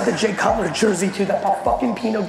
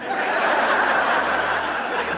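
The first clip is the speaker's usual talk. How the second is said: ordinary delivery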